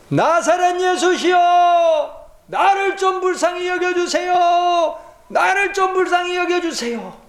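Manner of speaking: high, slow pleading cry; blind beggar crying out. Acted. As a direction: cry out desperately to be seen. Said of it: "바디메오가 소리를 지르기 시작합니다".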